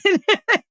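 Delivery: a burst of high laughter; sudden high laugh. Real, not acted. Laughing at her own slip.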